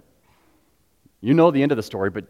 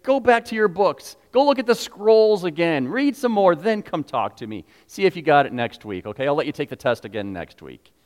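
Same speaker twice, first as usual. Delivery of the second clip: high-pitched, brisk brush-off; hammed-up impatient tutor; dismissive teacher; 6 semitones higher, 5 dB louder than usual. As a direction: send him off to study like a stern tutor. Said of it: "Did Jesus say".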